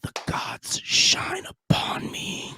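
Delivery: slow, hushed whisper of reverence; awed whisper. Retold or imitated. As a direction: whisper it like a revelation from above. Mock reverent awe.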